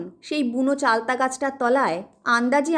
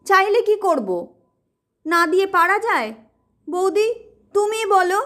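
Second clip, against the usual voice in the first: high, raised, indignant protest; angry protest; 6 semitones higher than usual. Acted. Son protests angrily to sister-in-law, high and raised. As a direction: snap back defensively. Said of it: "রেগে উঠে বলে".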